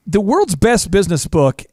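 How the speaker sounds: normal talking voice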